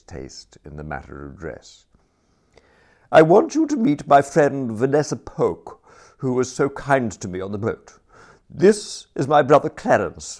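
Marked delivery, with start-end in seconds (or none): 3.1-10.4 higher and louder, a slightly apologetic society introduction; Lady Constance introducing. A narrator's reading. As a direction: present him a little reluctantly. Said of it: "said Lady Constance, with that touch of the apologetic".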